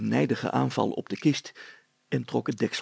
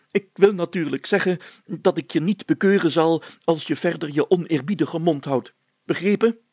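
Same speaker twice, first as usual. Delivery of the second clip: high, loud self-important mayor voice; pompous mayor; 6 semitones higher, 9 dB louder than usual. A narrator's reading. Mayor threatening Brilstra with a fine, pompous and high. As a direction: lecture him like a puffed-up mayor.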